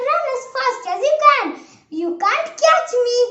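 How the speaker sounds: high, loud storybook taunt. A narrator's reading. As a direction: call it out to your chasers. Gingerbread man taunts his chasers.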